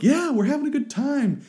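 over-bright prisoner cheer; mock cheery. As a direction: sing out a falsely happy line. Mock voice of jailed band.

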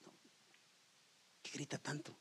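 whispered shushing; hushing whisper. Retold or imitated. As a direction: hiss to hush him. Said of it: "le dicen".

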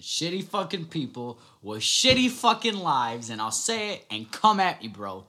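loud, high, slow ranting; angry outburst. Real, not acted. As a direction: let the anger spill out loud. Venting at people sending threats.

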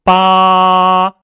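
八 very high, loud and level; unchanging first tone. Shown as a demonstration. Teacher showing no pitch change, 八 high and level. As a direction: hold 八 steady without any rise or fall. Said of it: "没有高低变化".

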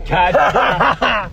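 high, loud laughing gloat; laughing taunt. Real, not acted. Friend gloats over winning the spot, laughing. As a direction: crow it loudly through laughter.

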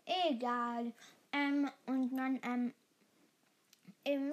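ordinary delivery

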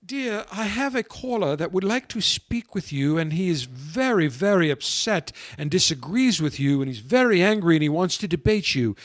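imitated British hostess accent. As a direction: play the British hostess addressing the general. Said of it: "in her British accent".

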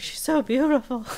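mock-dreamy cartoon sigh; swooning Mabel. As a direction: coo it like a lovestruck girl.

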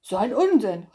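loud, dismissive bear shout; loud retort. Acted. Bär shouts down Hase's claim, loud. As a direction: bellow it out to dismiss him. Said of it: "rief Bär".

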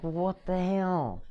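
stunned. Retold or imitated. Stunned as everyone claps.

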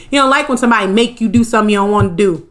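ordinary talk, nothing marked

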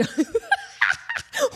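silly vocal drum fill; goofy mouth drums; mouth drum noise. Retold or imitated. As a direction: mimic a drum solo with the mouth. Imitating drum sound with mouth.

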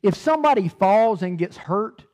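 ordinary delivery

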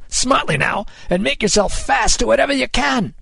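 high, urgent captain's command; rally the crew over a gale; called-out order. A narrator's reading. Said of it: "he called".

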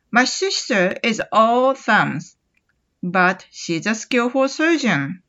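very slow, careful reading; slow model sentence. Shown as a demonstration. Teacher reads slowly for students to repeat. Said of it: "これも後に続いてやってみ".

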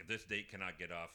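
muttered aside, dropping low and soft; softly grumbled thought; quiet inner thought. Retold or imitated. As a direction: mutter it under your breath like a private groan. Inner thought on a bad date.

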